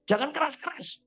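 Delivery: high-pitched imitation of complaining mother; mock motherly complaint. Mother telling son not to shout, mock complaint. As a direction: imitate mama grumbling about noise.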